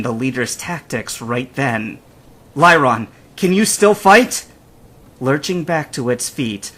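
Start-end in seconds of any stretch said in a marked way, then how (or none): 2.6-4.4 raised voice, climbing question; loud, pressing check-in; urgent call. Acted. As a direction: call out to your hurt partner. Trainer calls to injured Pokemon.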